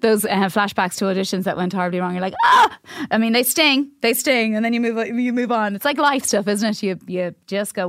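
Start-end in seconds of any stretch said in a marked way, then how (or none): 2.4-2.8 short, high, loud cry of dismay; mock horrified yelp. Actor hit by audition flashback, mock horrified yelp. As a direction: yelp as at a cringing memory.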